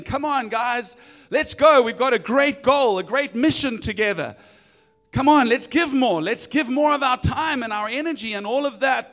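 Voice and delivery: loud rallying cheer; pep talk. Retold or imitated. Pastor mimics himself hyping up the church. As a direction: fire the team up, come on let's go. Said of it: "I'm good at motivating people and saying".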